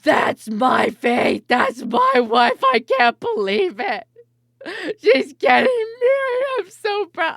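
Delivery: sob-yell with pride like a hammed-up mom; obnoxious wedding cheer. Streamer imagining herself screaming at Faith's wedding, hammed-up sob-yell. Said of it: "just be like".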